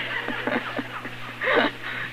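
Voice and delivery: soft, breathy real laugh; airy laugh. Real, not acted. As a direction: laugh at the sergeant's disbelief. Laughing at sergeant's doubt.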